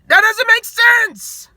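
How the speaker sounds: high, slow, stretched-out disbelief; can't believe the team went eight and eight. Real, not acted. Ranting about the Cowboys' season.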